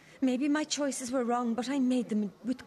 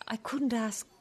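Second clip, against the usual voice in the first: ordinary talk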